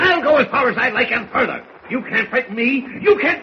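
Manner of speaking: loud, high-pitched defiant outburst; angry defiance. Acted. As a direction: fire back angrily, voice rising. Editor defies the official, quarrel.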